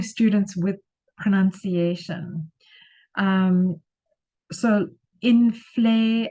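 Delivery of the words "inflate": inflate said slowly, stress on the second syllable, in-FLATE; teacher models inflate slowly with the stress on FLATE. Shown as a demonstration. Teacher shows students the word stress: slow, stressed on FLATE. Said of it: "I've put that in capital letters so that you know that that's where the stress is".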